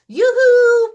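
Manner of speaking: very high, loud yoo-hoo; calling out. Acted. Rabbit calling for Hermit Crab, very high and loud. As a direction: call across the park, way up high. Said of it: "Rabbit calls".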